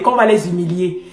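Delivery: ordinary talk, nothing marked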